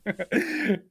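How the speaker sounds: high giggle; a breathy high laugh; high-pitched laugh. Real, not acted. Laughing at innuendo.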